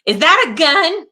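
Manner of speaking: high pretend panic; hammed-up startled question; mock alarm. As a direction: act alarmed, voice jumping up. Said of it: "is Eileen going".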